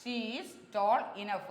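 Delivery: "she is tall enough" said slowly and clearly, leaning on "tall"; teacher modelling "she is tall enough" slowly; slow model sentence. Shown as a demonstration. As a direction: spell out "she is tall enough" word by word for the class. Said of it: "എന്നല്ല".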